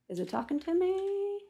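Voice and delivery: airy put-on wondering voice; mock inner voice. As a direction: stretch the last word, softly and high. Acts out listening for inner voice.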